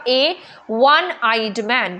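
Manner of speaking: very slow, with वन said as wun; careful teacher read-aloud; slow demo read. Shown as a demonstration. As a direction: spell out वन with its W sound. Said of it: "व, व की sound आ रही है ना".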